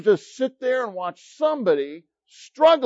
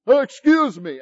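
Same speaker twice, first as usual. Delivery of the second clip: startled excuse me; 5 semitones higher, 7 dB louder than usual. Retold or imitated. Stranger startles him from behind.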